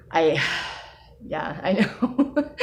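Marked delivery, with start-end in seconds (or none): 1.6-2.6 laughing. Real, not acted. Laughing through emotional admission.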